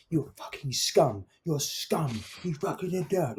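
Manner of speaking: going off at him. Retold or imitated. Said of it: "literally going off at him".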